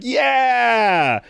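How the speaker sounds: long, high drawn-out cheer; stretched high hurrah; imitated crowd cheer. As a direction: cheer like a whole arena. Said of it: "everyone was like".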